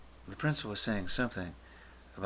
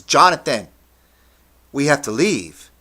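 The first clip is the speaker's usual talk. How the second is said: loud, raised, insistent call; urgent teacher's shout; 5 semitones higher, 14 dB louder than usual. Retold or imitated. History teacher ordering student out of class, loud insistent call. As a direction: shout over the noise to get through. Teacher cutting through the hysteria.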